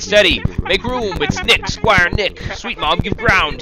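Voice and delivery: loud, slow call to make way through the crush; calling out over a crowd. Acted. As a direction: shout them aside so he can pass.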